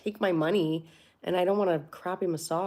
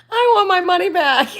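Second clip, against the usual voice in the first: high, loud demand through laughter; laughing outrage; 13 semitones higher, 15 dB louder than usual. Pretend. Picturing the bad massage, she laughs out a loud high demand. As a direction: shout it while cracking up.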